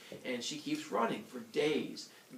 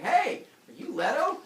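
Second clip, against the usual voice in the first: loud, high call-out; Hera; 12 semitones higher, 9 dB louder than usual. Retold or imitated. Goddess stops a walker on the road, loud challenge. Hera accosts Leto, acted.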